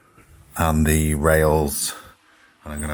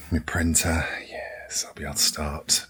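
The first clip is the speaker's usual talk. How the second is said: whisper; 2 semitones lower, 8 dB quieter than usual. Real, not acted. Delighted aside over printer.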